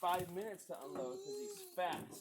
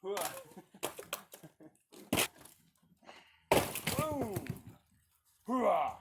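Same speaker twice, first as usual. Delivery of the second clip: loud gung-ho soldier; 3 semitones higher, 4 dB louder, much slower than usual. Acted. Dad cheering kids unloading wood.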